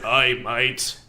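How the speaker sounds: hammy Australian greeting; mocking Australian accent. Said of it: "that's how you sounded".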